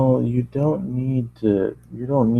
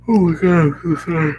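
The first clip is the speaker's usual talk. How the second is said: flustered apology; 7 semitones higher, 3 dB louder than usual. Acted. Voice apologizing in story.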